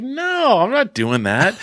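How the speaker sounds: high, drawn-out mock protest; retold appalled no, starting way up; indignant refusal. Man refusing his girlfriend's makeup request, indignant.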